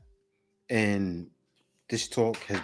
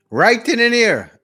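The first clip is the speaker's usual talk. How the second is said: emphatic loud outburst; 9 semitones higher, 9 dB louder than usual. Real, not acted. Host reacts emphatically.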